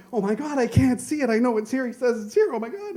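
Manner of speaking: put-on high, frantic child's fluster; a boy searching in a rising panic; panicked boy. Boy panics, can't find wrench.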